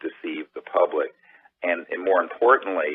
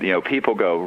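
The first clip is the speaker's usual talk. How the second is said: ordinary talk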